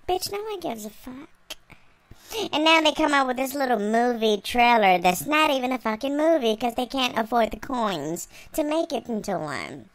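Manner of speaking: ordinary talk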